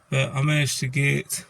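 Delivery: ordinary talk, nothing marked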